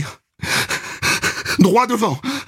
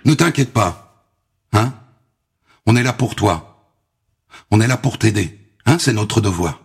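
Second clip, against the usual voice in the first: reassuring; 5 semitones lower than usual. Acted. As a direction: comfort a frightened child. Police reassure girl. (voix rassurante)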